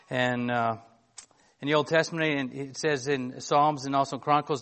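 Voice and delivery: ordinary talk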